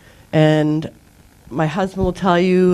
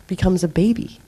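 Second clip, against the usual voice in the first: usual speaking voice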